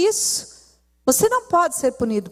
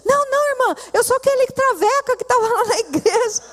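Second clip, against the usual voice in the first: much higher, eager voice cutting in; pleading former travesti; 8 semitones higher than usual. Retold or imitated. Preacher voicing the former travesti who insists she knows him, high and pleading. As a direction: rush to reassure her, pitched high.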